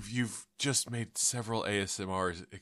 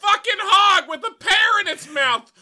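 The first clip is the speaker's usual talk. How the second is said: very high, very loud raging pig voice; furious hog squeal; 13 dB louder than usual. Acted. Hog outraged at the pear, furious squealing. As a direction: rage like an insulted pig.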